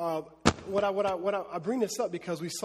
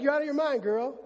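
louder, higher mock brush-off; dismissive; 7 semitones higher, 4 dB louder than usual. Crowd scoffing at the servant girl, mock dismissive. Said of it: "they're like".

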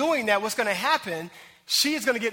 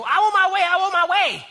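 high, loud whining demand; whiny quarrelsome wife; 9 semitones higher, 8 dB louder than usual. Retold or imitated. Pastor mimicking a quarrelsome wife, high loud whine. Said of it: "So you go".

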